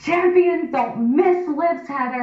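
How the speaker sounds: high, slow, pointed coach scolding; coach in my face. Retold or imitated. Coach scolding his lifter up close. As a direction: jab the words at her like a finger. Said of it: "Bill gets in my face and his finger".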